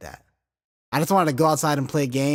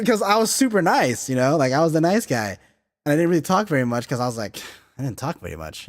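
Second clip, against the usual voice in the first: usual speaking voice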